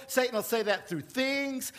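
ordinary delivery

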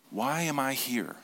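drawn-out reflective repeat. Shown as a demonstration. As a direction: ask it again slowly and softly.

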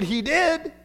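high amused surprise with a laugh; surprised. Retold or imitated. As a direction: react amazed, laughing. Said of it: "I said".

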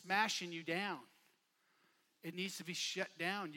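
normal talking voice

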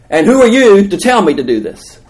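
loud, high-pitched protest; indignant challenge. Acted. Acts Moses challenging God.

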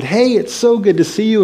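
high-pitched, delighted hello; excited greeting. Retold or imitated. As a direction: greet a friend you're thrilled to run into. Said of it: "she was really excited".

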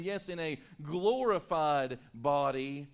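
normal talking voice

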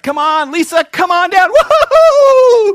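loud, high, stretched-out host call with whoops; game show announcer. Pretend. Host calling Lisa down to the stage, booming and high.

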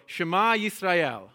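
slow call, "Shema Yisrael" with the Y sounded; slow Hebrew call. Shown as a demonstration. Teacher calling out Hebrew for the congregation to repeat, slow and clear. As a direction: lead the call slowly for them to echo. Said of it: "So we're gonna try that again".

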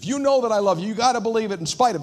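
loud, high, pushy insistence, rushing the end; manipulative plea. Shown as a demonstration. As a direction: press it on them like a guilt trip. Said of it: "that could be manipulative".

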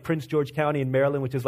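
ordinary delivery

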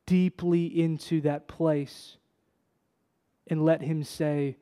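usual speaking voice

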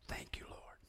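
whispered prayer of thanks; hushed thanks. Retold or imitated. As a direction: whisper it as a private, awed thought. Awed inner thanks after the baptism.

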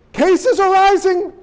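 high, slow panicky announcement; mock the fearmongering headline; hammed-up panic, pitched high; alarmist news voice. Repeating the alarmist line.

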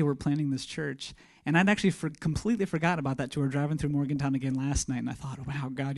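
normal talking voice

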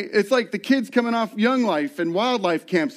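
normal talking voice

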